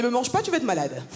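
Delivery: normal talking voice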